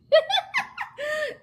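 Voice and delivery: a sudden high laugh; high burst of laughter. Real, not acted. Vlogger laughing at a kid's question, high laugh.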